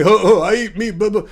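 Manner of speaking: mock a dim caveman bragging; lightly hammed-up caveman voice; dumb meat eater voice. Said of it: "I was doing my dumb meat eater voice".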